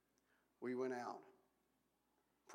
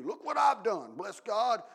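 much higher and louder, bragging harder with each line; boastful mock shouting; 9 semitones higher, 18 dB louder than usual. Preacher mocking a believer who brags to God, shouted. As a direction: puff up and shout your own praises.

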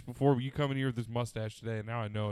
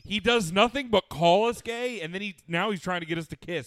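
high, loud exasperated outburst; loud protest; 7 semitones higher, 9 dB louder than usual. Real, not acted. Friend calling out Blake for teasing, loud and exasperated.

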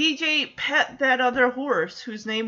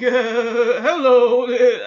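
loud, failed cartoon voice attempt; Elmer Fudd; 7 dB louder, much slower than usual. Trying a duck that sounds like a cartoon, botched voice. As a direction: attempt a cartoon duck's hello, then give up. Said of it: "I can't do an Elmer Fudd".

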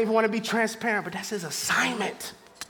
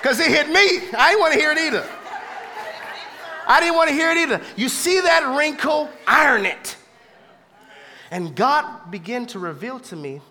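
loud, high climax of a sermon, near shouting; preaching at full force; 4 semitones higher, 8 dB louder than usual. Acted.

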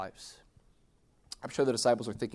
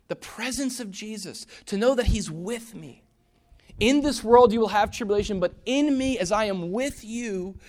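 preaching emphasis; 7 semitones higher, 6 dB louder than usual. Acted. Slow preaching on presence.

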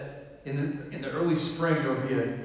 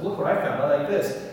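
higher, louder excited colleague; excited showing-off; 5 semitones higher, 8 dB louder than usual. Retold or imitated. Colleague showing a picture on a Zoom call, excited. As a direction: pipe up, eager to show off a find.

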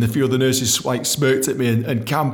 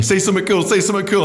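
panicky inner urging; 8 semitones higher, 3 dB louder than usual. Retold or imitated.

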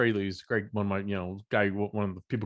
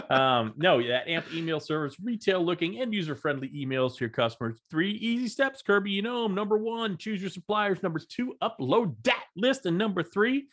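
ad read; 5 semitones higher than usual. Acted. Ad read for sponsor.

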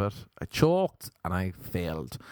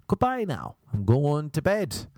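see someone off in a raised voice; firm send-off; 6 semitones higher than usual. Acted. Comedian acting out sending a date away, firm high-pitched send-off.

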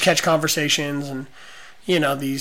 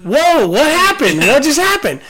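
mock surprise; 10 semitones higher, 6 dB louder than usual. Reacting to friend's sudden outburst.

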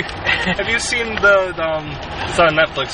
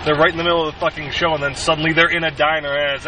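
ordinary delivery

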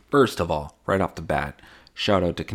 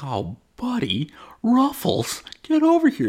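high excited dog-greeting voice climbing higher; calling the dog. Retold or imitated. Soldier home from war calling his dog, high squeal. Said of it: "I'm like".

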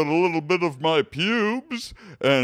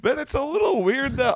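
game show announcer. Acted. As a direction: boom it like a prize announcer. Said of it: "You sound like a game show announcer".

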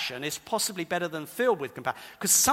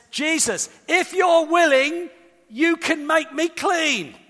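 pleading cry; 8 semitones higher, 6 dB louder, slower than usual. Acted. Leper pleading on his knees.

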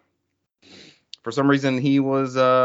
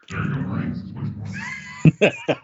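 booming evil demon laugh; demonic laugh. Acted. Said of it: "There's the demon".

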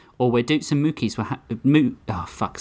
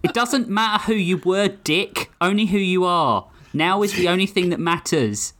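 fierce declaration; 8 semitones higher than usual. Acted. Tamiya after punching Doots.

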